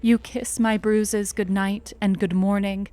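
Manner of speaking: usual speaking voice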